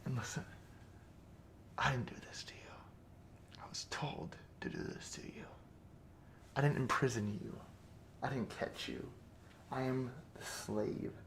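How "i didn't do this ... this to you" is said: whispered, confiding self-defence; soft whispered justification; hushed excuse. Acted. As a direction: whisper it to the captive, disowning blame. Excusing himself to the captive.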